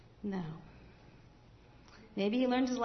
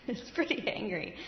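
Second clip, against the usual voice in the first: repeated with a laugh, softer; 4 dB quieter than usual. Real, not acted. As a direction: laugh through the words.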